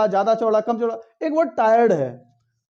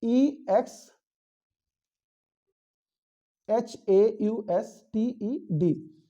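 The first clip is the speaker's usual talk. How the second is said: slow spelling out; much slower than usual. Shown as a demonstration. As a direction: spell each letter slowly, one by one. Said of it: "कि exhausted क्या होता है".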